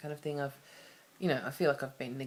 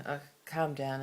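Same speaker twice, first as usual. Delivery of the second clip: normal talking voice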